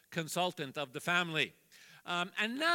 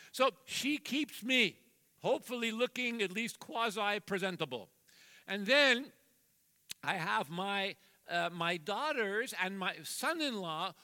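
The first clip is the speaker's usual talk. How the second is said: usual speaking voice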